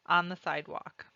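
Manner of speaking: ordinary delivery, nothing marked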